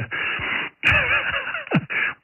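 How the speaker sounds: short airy laugh, unvoiced; breathy laugh. Real, not acted. Radio operator laughing at his own joke about buying a kit. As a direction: let out a quick breathy laugh.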